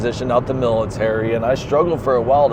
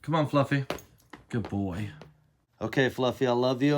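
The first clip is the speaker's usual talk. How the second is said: tender; 10 dB quieter than usual. Real, not acted. Saying goodbye to his dog.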